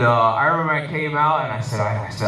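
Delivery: ordinary delivery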